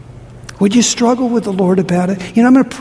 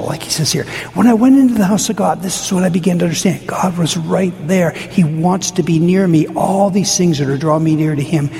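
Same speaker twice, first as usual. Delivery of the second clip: normal talking voice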